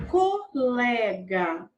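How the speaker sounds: "Colega" very slowly, each syllable held. Shown as a demonstration. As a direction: draw it out as co... le... ga. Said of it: "Separe a palavra em sílabas". ("Colega" bem devagar, sílaba por sílaba)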